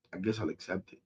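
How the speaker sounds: near-silent, sinking repeat. Real, not acted. Fan repeating acceptance to viewers, fading to near silence. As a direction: fade it out, defeated.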